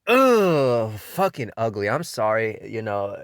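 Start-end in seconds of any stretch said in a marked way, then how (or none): 0.1-0.9 long, loud "ugh", pitched high; disgusted groan. Real, not acted. Reviewer recoiling from ugly manga art, disgusted groan. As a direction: groan it out in revulsion.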